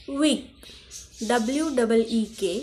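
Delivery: ordinary talk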